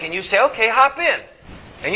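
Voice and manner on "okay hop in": mock cheerful. You agreeing to drive the robber.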